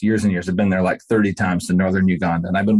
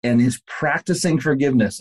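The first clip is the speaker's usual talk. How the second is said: amazed; 7 semitones higher than usual. Retold or imitated. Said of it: "And I'm like".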